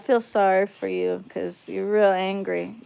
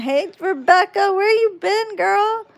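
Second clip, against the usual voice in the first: much higher, bright sing-song hello; excited greeting; 11 semitones higher than usual. Real, not acted. Streamer greeting a long-absent viewer, excited high greeting.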